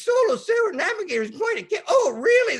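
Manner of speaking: very high, loud, excited voices; excited chatter. Retold or imitated. Operator's colleagues excited about a solo sailor, very high. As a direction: squeal with excitement.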